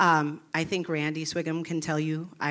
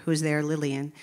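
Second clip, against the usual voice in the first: ordinary talk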